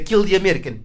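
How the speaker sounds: mock Arabic accent. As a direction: imitate a movie rebel's Arabic accent. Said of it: "όταν είναι από αραβικές χώρες". (μίμηση αραβικής προφοράς κακού ταινίας)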